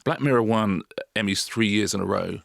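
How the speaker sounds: ordinary talk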